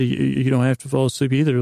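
ordinary talk, nothing marked

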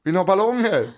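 put-on loud, high call to the children; rally a room of kids. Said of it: "Och jag bara".

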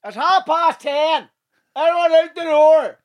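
very high, slow, stretched-out shout; strict closing-time call. Acted. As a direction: herd drinkers out like a hammed-up barman. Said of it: "how strict they are with fucking closing times".

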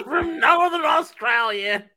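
high, slow Southern holler; hammed-up Alabama accent. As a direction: shout it in a silly Alabama accent. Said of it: "You sound like you're from Alabama".

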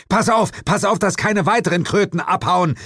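high, urgent call to a partner. A narrator's reading. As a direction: shout a warning across the shop. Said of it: "rief ich Jane zu".

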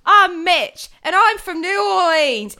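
loud, high, hammed-up New Orleans accent; bad New Orleans accent. Podcaster playing Stanley's friend, loud fake New Orleans accent. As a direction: belt it in a botched New Orleans twang. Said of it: "It's set in New Orleans".

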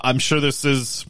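ordinary delivery

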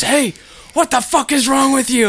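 high, loud mock yell; hammed-up road rage yell; imitating road-rage dad. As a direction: bark it out the truck window at the kid. Dad yelling at driver.

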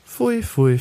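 high, squealing mock disgust; disgusted pfui. Narrator recoiling from the word Ja, high disgusted pfui. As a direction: shriek the pfui up high in revulsion.

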